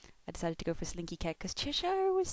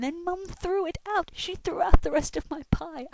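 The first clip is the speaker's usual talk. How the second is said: higher, louder, outraged whine; mock-outraged protest; indignant; 10 semitones higher, 9 dB louder than usual. Real, not acted. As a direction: complain about the lost pie. Complaining Mum threw pie out.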